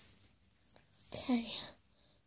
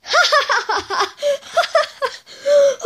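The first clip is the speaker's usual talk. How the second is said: high, pealing cackle; long high-pitched hammy laugh; mocking laughter; 11 semitones higher than usual. Character laughs off the threat.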